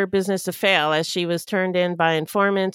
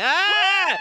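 anguished cry; 13 semitones higher than usual. Real, not acted. Dread about election day.

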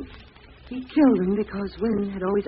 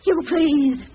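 high, loud desperate begging; terrified pleading; 8 semitones higher, 8 dB louder than usual. Acted. Lona begging the masked killer to release her, terrified. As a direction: plead for your life.

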